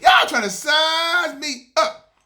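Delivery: high, loud, slow drawn-out shout; hyped shout. Acted. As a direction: stretch it out like a hype man. Hyped promo.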